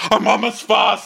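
high, declaimed insult; haughty mock outrage. Envoy insulting the prince, haughty mock outrage. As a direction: throw it out high in hammed-up disdain.

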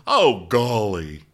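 slow, loud, drawn-out exclamation; lightly put-on voice, stretching each word; Reed. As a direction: draw it out loudly as a mock quote. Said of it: "Reed goes".

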